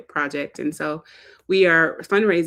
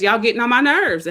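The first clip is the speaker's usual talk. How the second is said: mock scolding; 5 semitones higher, 6 dB louder than usual.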